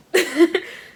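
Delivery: sudden loud rising laugh; burst of laughter. Real, not acted. Telling friends the story, she bursts out laughing. Reacting to Troll and Snickers.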